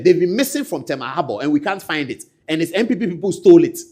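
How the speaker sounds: ordinary talk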